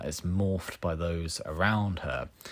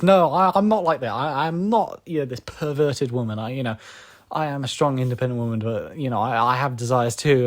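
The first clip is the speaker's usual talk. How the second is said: higher-pitched mock indignant denial; freaked-out protest; 5 semitones higher, 4 dB louder than usual. Reviewer voices Misato freaking out at seeing herself, mock protesting. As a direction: imitate a character protesting as she freaks out. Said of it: "You know, she freaks out".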